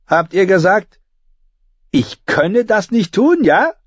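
high, cold threatening question; menacing young king. A narrator's reading. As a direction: dare her to repeat it. Joffrey menaces Sansa.

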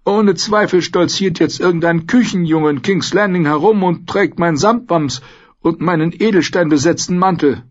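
high-voiced old lord, musing; lost in thought. A narrator's reading. Lord musing about his lost wardrobe, absent-minded. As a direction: ramble on in a high, preoccupied voice. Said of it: "fuhr Lord Alistair gedankenverloren fort".

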